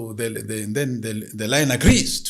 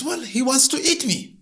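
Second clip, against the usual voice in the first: worried woman; 13 semitones higher, 5 dB louder than usual. Acted. Woman complains to the spirit.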